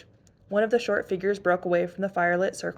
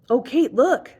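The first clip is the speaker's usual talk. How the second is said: higher, louder eager cry; excited call; 7 semitones higher, 8 dB louder, slower than usual. Acted. Emily calling her sister to see the cat, excited. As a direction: call out, thrilled. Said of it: "Emily called excitedly".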